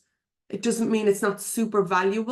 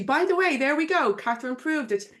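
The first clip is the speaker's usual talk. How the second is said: ordinary talk, nothing marked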